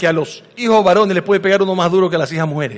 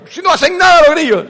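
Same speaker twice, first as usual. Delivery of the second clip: high, louder mock of a dismissive parent; 9 semitones higher, 4 dB louder, faster than usual. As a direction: brush off the fear like an impatient parent. Padre desprecia el miedo. (imitando a un padre que desprecia el miedo, agudo y más fuerte)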